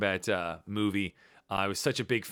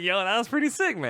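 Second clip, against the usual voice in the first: excited quote; 8 semitones higher than usual. Retold or imitated. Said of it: "I was like".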